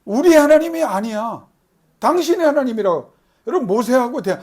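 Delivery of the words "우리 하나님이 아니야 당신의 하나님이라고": higher, louder mock retort of the people; sulky people. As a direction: throw the words back at God petulantly. Voicing the people rejecting God.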